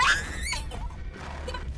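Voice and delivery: high wheezing laugh; helpless squeaky laugh, trailing soft. Real, not acted. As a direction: break up into a high, airy laugh. Laughing at own bad play.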